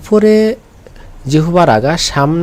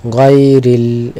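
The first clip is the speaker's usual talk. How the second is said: গায়রিল slow and firm, a little louder; slow recitation; 5 dB louder, much slower than usual. Shown as a demonstration. Teacher reads the verse to find lam, reciting slowly. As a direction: draw out গায়রিল.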